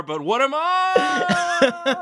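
very high, slow playground comeback; spinning politician. Acted. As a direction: squeak out a dodge like a candidate. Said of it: "crape your way out of it".

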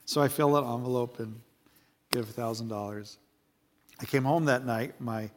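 normal talking voice

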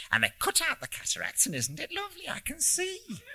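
put-on North Country old lady lifting high in delight; softly thrilled, in a North Country accent. A narrator's reading. Old lady marvelling that she can see, North Country accent. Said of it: "in an English North Country accent".

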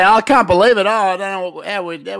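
mimicking his partner, loud and high, hammed up; teasing echo of his co-host; mock excitement. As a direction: throw his line back at him, hammed-up. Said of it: "You say".

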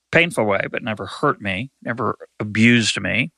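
usual speaking voice

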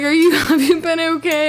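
high, loud weepy whine; mock tearful. Imitating herself checking on ex, mock tearful. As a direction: ask in a laughing fake cry. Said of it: "I'm literally crying".

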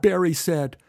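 ordinary delivery

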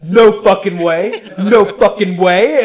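loud, slow, stretched-out astonishment; imitated disbelief. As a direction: drag each word out in mock amazement. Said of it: "like, in disbelief".

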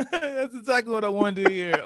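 high-pitched, amused agreement; real laughter in the words; laughing. Real, not acted. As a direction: talk through the tail of a laugh.

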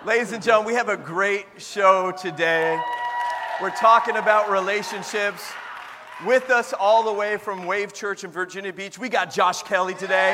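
loud booming host intro; show host announcing. Acted. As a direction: announce it big to the crowd. Opens the show to audience.

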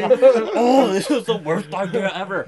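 hammed-up coughing kid; mock choking gasp. Retelling choking on hose water.

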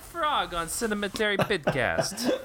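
high froggy puppet voice through laughter; a silly Kermit voice, laughing. Pretend. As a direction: keep the frog voice while cracking up. Host intro done as Kermit.

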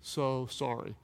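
very slow, hushed apology; broken contrition. Acted. Sinner praying under the weight of sin, broken contrition. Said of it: "you just broke down before God".

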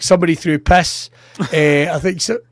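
ordinary talk

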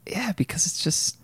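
breathy giggling whisper; whispered laugh. Real, not acted.

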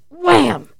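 high, loud impact shout; loud startled exclamation; sudden wham. Retold or imitated. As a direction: blurt out the hit like a crash.

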